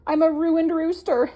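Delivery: high, loud cry of despair; exclaiming. Acted. Dudley crying out that the goose will ruin him. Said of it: "exclaimed Dudley".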